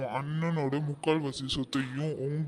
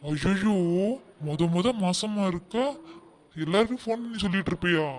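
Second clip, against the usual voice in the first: higher, hammed-up horror; mock alarm; 5 semitones higher, 3 dB louder than usual. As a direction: throw up your hands in fake shock. Mother-in-law feigns alarm. (போலியான பதற்றம்)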